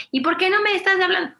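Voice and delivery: pregunta aguda de niño que reclama; voz de niño dolido, más aguda; reclamo de niño. Retold or imitated. (el hijo le reclama a mamá que no le habla, agudo y quejoso)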